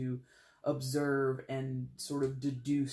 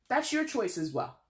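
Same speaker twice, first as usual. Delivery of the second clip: sassy, higher pitch, a spicy little jab; 7 semitones higher, 4 dB louder than usual. Real, not acted. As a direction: toss it off with playful sass. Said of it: "I don't know why I had to get a little sassy, a little spicy".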